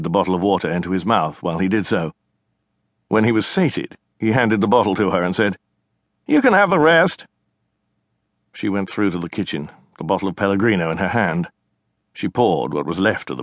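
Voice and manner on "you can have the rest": higher, louder teasing brother voice; cocky brother. Acted. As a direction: taunt her like a smug kid brother.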